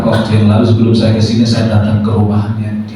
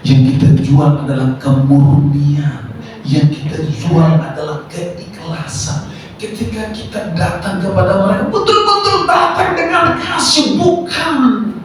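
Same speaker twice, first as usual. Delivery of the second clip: building preaching fervour; 9 semitones higher than usual. Real, not acted. Preacher urging servants to come with love, building preaching fervour. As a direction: pitch climbing steadily to a high, strained plea.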